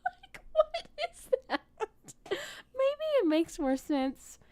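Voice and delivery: squeaky, high through tears of laughter; pushing words out between laughs; laughing disbelief. Real, not acted. Still laughing at the accent.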